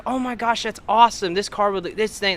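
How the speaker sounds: high-pitched imitation of a thrilled woman; gushing delight. Woman praising teens' car project, gushing. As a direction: gush as if completely taken in.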